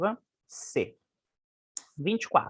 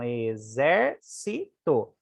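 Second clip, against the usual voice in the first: "exército" slowly in syllables, leaning on ZÉR; stress on antepenultimate syllable; 6 semitones lower, much slower than usual. Shown as a demonstration. Teacher repeating the word to show its stress, slow syllables. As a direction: stretch out "exército" with weight on ZÉR. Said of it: "antepenúltima mais forte".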